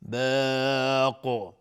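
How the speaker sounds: باق held long and stopped with a bouncing qalqala on the ق; stopping on qaf. Shown as a demonstration. Teacher showing students how to stop on باق. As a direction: stretch باق and end with an echoing ق. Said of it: "قاف اگر توقف کردیم".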